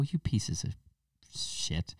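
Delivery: soft under-the-breath insult; muttered curse. Acted. Swearing at poll voters under the breath. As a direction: hiss it under your breath.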